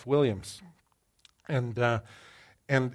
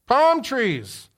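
excited echo; 10 semitones higher, 7 dB louder than usual. Real, not acted. Echoing audience answer.

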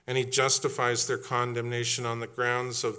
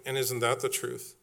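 ordinary talk, nothing marked

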